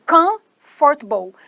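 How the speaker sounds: "Comfortable" slowly in two chunks, COMF-tble; two-sound pronunciation. Shown as a demonstration. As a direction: split "Comfortable" into two beats, first one punched. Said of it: "tem dois sons".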